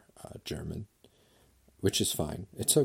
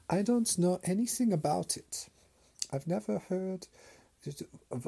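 high, hammed-up German accent, feigning innocence; mock German grandpa; 10 semitones higher than usual. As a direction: play a German grandpa pretending he knew nothing. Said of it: "and he's like".

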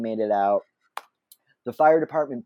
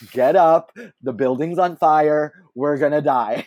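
loud, retold urgent command; emphatic, raised-voice warning; urgent alarm; 3 semitones higher, 5 dB louder than usual. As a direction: imitate someone raising the alarm. Retells partner's fire warning.

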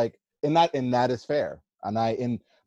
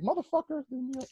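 much higher, squeaky mock girl voice; mock annoyed girl; 7 dB quieter than usual. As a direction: play the fed-up woman, pitched way up. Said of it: "She's like".

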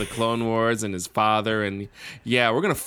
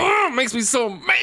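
mock angry shout; 10 semitones higher than usual. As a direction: shout it in playful outrage.

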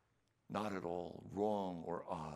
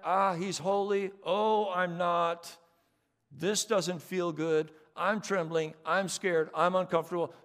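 anxious confession; 10 semitones higher, 12 dB louder than usual. Acted. Preacher acts the sinner's fearful realization, high and loud. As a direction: cry out in dread. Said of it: "You put the pieces together".

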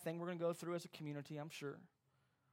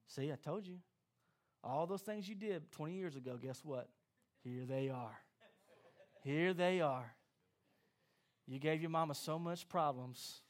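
mocking enemy; 7 semitones lower, 3 dB quieter than usual. Voicing the enemy's taunt.

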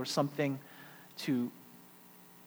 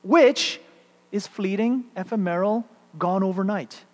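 lyrical recitation; 4 semitones higher, 6 dB louder than usual. Shown as a demonstration. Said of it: "a lyricism to these last two verses".

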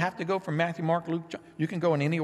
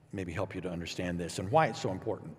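ordinary talk, nothing marked

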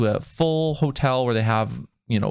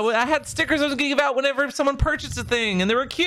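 much higher, worked-up complaint; upset and worked up; 11 semitones higher than usual. Real, not acted. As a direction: pitch it up, playfully upset. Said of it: "And I'm so upset".

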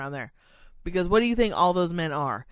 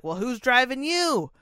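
sarcastic comeback; 7 semitones higher, 4 dB louder, slower than usual. Retold or imitated. Retorting to sexist passenger.

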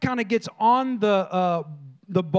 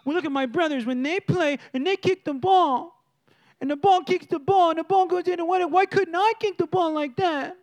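put-on higher-pitched little-boy whine; hammed-up kid complaint, building higher; complaining kid; 4 semitones higher than usual. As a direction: imitate a child griping about his brothers. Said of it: "He started comparing".

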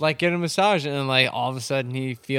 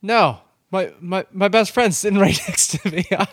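flustered refusal; 9 semitones higher, 9 dB louder than usual. Retold or imitated. Friend refuses the offer, flustered.

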